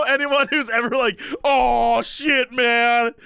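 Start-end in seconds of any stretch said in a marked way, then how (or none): 1.4-3.1 shouted dopey stoner; loud stoner. Retold or imitated. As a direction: yell like a lost stoner. Mocking overacted stoner.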